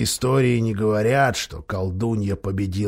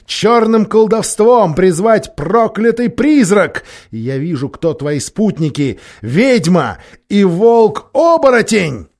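loud, high, slow thunder; 9 semitones higher, 7 dB louder, slower than usual. A narrator's reading. Caspian denounces Nikabrik's plan, thundering voice. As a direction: thunder the accusation. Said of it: "прогремел голос Каспиана".

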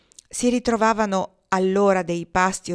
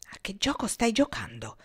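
murmur; 2 semitones higher, 3 dB quieter than usual. A narrator's reading. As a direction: whisper it so nobody hears. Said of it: "mormorò a bassa voce Gromov". (Gromov sussurra al compagno, sottovoce)

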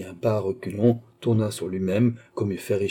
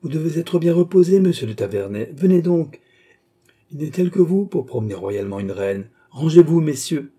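higher, louder call to the crowd; 6 semitones higher, 6 dB louder than usual. A narrator's reading. Queen calling to Taverney from her cortege, loud and high. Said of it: "elle criait en passant".

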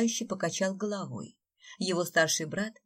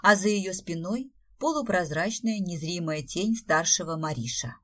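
ordinary delivery

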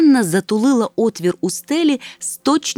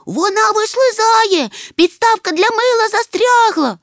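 loud, high, slow panicked yell; 13 semitones higher, 5 dB louder than usual. A narrator's reading. Moomintroll yelling while the bathtub slips, high panicked shout. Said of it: "репетував мумітроль".

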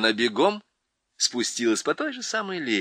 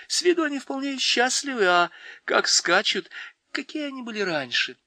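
put-on a girl's curious voice, a bit higher; lightly voiced girl Lucy; 13 semitones higher than usual. A narrator's reading. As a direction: wonder aloud like an excited little girl. Lucy's voice, much higher. (высоким девичьим голосом, с любопытством)

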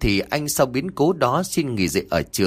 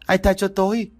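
screaming out; 10 semitones higher than usual. A narrator's reading. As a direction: scream each word out. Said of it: "gào lên từng tiếng". (gào lên từng tiếng, giọng rất cao)

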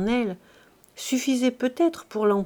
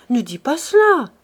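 raised, loud desperate plea; imploring; 5 semitones higher, 8 dB louder than usual. A narrator's reading. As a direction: beg loudly, pitch lifted. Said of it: "implora Silver". (supplication vive et forte)

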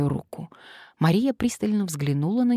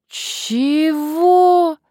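exasperated; 12 semitones higher, 9 dB louder than usual. Acted. Man's third baffled reply.

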